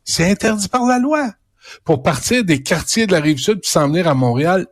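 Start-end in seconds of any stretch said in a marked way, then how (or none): 0.0-1.3 mocking echo.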